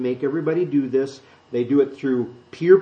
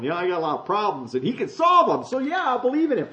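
mock eager convert; 6 semitones higher than usual. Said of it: "And they're going".

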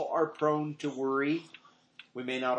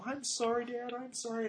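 apologetic daughter; 9 semitones higher, 5 dB quieter than usual. Retold or imitated. Daughter apologizing, voiced in story.

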